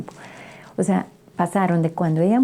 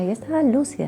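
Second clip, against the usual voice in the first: ordinary talk, nothing marked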